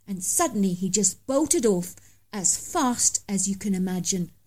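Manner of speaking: ordinary delivery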